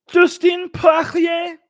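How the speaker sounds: exaggerated French accent, high and drawn out; hammed-up French accent, pitched high, slow and shouted. Shown as a demonstration. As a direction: stretch the name slowly like a hammed-up Frenchman, loud and high. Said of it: "saying Poirier in French accent".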